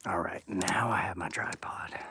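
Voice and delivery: hushed whisper while walking in the dark; whispering out in the woods at night. Real, not acted. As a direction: keep it to a breathy whisper so nothing hears you. Whispering while fetching tripod.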